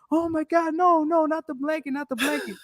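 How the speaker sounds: high-pitched imitation of a distressed woman; mock panic, squealed up high; panicking woman impression. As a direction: act out her frantic plea in falsetto. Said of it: "And she's like".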